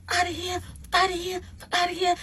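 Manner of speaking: very high mock scream; imitated screaming. Date screaming outside at the woman, imitated screech. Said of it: "he starts, like, screaming".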